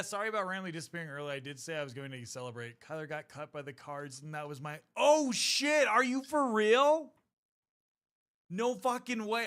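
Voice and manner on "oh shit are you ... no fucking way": high, loud shocked outburst. Real, not acted. Streamer reacting to chat's big news, loud shocked disbelief. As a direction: blurt it out in real disbelief.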